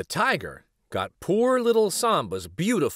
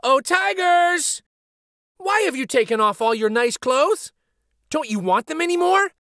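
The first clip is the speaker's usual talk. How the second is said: much higher and louder, calling to the tigers; boy calls to the fighting tigers; 13 semitones higher, 5 dB louder than usual. Acted. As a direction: call out across to them. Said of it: "called out".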